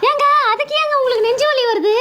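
wife startled by her husband's act; alarmed. Acted. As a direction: ask in alarm why he's in pain.

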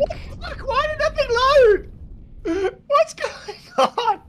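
shocked. Real, not acted. Game failed to load.

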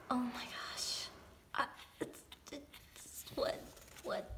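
breathless whispered oh my gosh; hushed, starstruck whisper; awed whisper. Real, not acted. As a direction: whisper it in disbelief.

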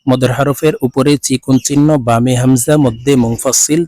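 ordinary delivery

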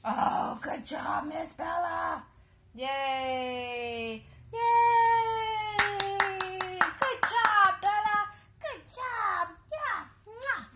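loud, high, cheering praise; excited praise. Real, not acted. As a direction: cheer the dog on after a clean run. Dog finishes agility run.

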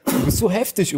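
ordinary delivery

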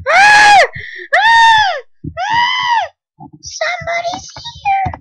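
very high, loud shrieks of fright; terrified screams. Real, not acted. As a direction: shriek in real terror. Scared by mob in house.

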